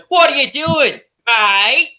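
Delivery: put-on high, loud, overdone Australian accent; hammed-up fake Aussie yell. Debater mocking an actress's line in the towed car, fake Australian accent. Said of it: "talking about a fake accent".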